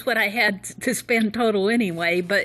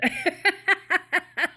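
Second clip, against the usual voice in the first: high burst of laughter; laughing; 9 semitones higher than usual. Real, not acted. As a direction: laugh at yourself. Laughing at own rudeness.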